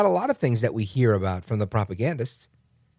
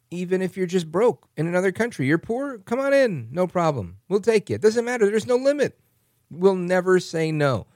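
mock welcoming; 6 semitones higher than usual. Mocking Biden's open-door policy.

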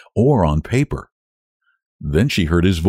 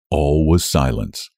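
ordinary delivery

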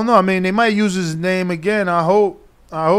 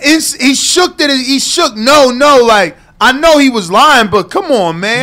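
outraged; 5 semitones higher, 6 dB louder than usual. Real, not acted. Outraged at stoppage.

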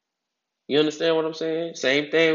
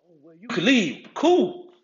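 dismissive retort; 5 semitones higher, 4 dB louder than usual. Retold or imitated. Mock dismissive retort to boss.

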